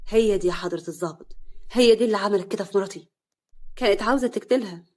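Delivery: loud, seething accusation; bitter anger. Acted. Husband accusing Nawal to the officer, loud and bitter. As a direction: spit the blame at her. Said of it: "حسن بغل".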